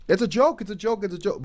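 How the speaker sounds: high-pitched, repetitive mimicry; mock laugh-track voice. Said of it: "like, a laughing track by him".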